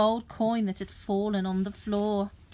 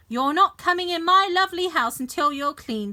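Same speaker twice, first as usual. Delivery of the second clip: scolding mum; 6 semitones higher, 4 dB louder, faster than usual. A narrator's reading.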